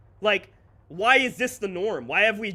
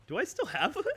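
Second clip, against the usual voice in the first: usual speaking voice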